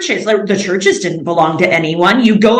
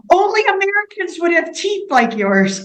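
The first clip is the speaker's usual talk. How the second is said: quoting them with a higher, laughing voice; amused quote through laughter; 8 semitones higher than usual. Retold or imitated. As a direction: relay the line while still giggling. Russians quoted about her teeth.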